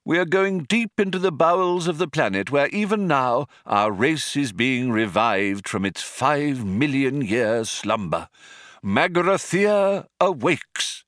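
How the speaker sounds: put-on high, grand old man, slowing toward the end; old man, portentous. A narrator's reading. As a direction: proclaim it as a solemn elder. Old man's grand announcement.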